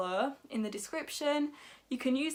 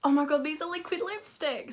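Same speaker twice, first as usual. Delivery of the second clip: real excited gushing, higher and louder; thrilled outburst with a big gasp; 5 semitones higher, 4 dB louder than usual. Real, not acted. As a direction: gasp and burst out with delight at the find. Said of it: "I get very excited".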